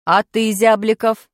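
slow, loud teacher's question; stern teacher quizzing class; strict teacher. Acted. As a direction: demand to know who has talent. Teacher calls pupil.

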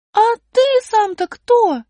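высоко и громко, недоумевая; маленькая героиня возмущённо спрашивает; высокий удивлённый голос. Acted. Аля спрашивает. (высокий удивлённый голос героини)

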